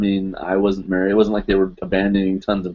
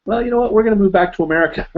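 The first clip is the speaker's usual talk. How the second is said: quoting parents; 12 semitones higher, much faster than usual. Retold or imitated. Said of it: "my parents called me and said".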